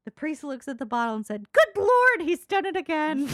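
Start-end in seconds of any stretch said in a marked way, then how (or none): 1.5-3.3 loud, high exclamation for a punchline; mock amazement. Priest marvels at the wine bottle, mock amazed. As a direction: cry it out as the astonished priest.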